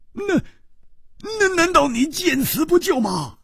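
can't believe his ears; high, disbelieving outcry; shocked Hu Tiehua. A narrator's reading.

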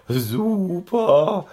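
high, joyful whoop. A narrator's reading. Pony whooping with joy at the puddle, high. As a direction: whoop with delight. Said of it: "jauchzte Schoko".

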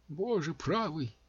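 high, slow shocked exclamation; exclaiming. A narrator's reading. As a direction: cry out in alarm, slowly. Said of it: "воскликнул отшельник".

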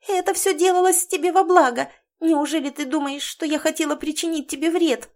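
high pleading mouse voice; a caught mouse begging. A narrator's reading.

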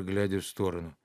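ordinary delivery

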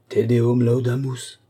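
slow, drawn-out church Latin; mock thanksgiving. Abbé giving thanks that the Chambertin is safe, mock-solemn. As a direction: intone it solemnly over the wine.

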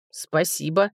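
reluctant, understated grumble; grumbled. A narrator's reading. Dwarf made to thank his rescuers, grumbling. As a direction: give thanks grudgingly. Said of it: "буркнул Брэга".

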